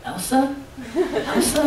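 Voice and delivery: put-on soft, high little-girl call, laughing; imitating little girl. Dad imitating his small daughter knocking on Elsa's door, softly laughing. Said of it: "and goes".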